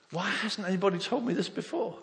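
high-pitched, eager retold question; excited astonishment. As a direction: burst out with it, pitch jumping up. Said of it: "I was so excited".

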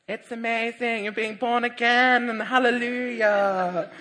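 high, loud, slow gushing prayer voice; rapturous church friend; hammed-up high ecstatic praise; ecstatic believer. [name] celebrating his rebirth.

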